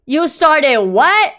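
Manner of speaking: loud, drawn-out incredulous question; shocked disbelief. Acted. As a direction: react aghast, stretching it out loudly. Shocked at motorcycle news.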